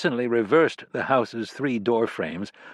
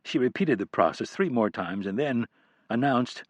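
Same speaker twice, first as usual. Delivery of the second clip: ordinary delivery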